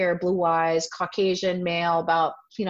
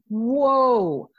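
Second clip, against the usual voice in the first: higher, drawn-out whoa; amazed, stretched-out exclamation; retold awe; 5 semitones higher, 4 dB louder than usual. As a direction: act out a sudden revelation. Retells a moment of revelation.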